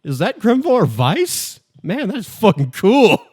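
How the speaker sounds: excited fan. Retold or imitated. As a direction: gush like a starstruck fan, stretching the words. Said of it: "to act a little bit like".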